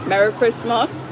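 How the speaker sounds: ordinary talk, nothing marked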